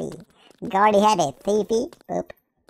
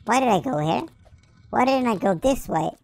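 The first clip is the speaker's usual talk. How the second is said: usual speaking voice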